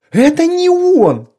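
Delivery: high, slow panicked cry; frightened yell. A narrator's reading. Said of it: "под аккомпанемент испуганного вопля".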